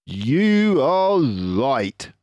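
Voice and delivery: a drawn-out, knowing Mister Fox. Acted. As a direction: clever fox leader, pleased with himself.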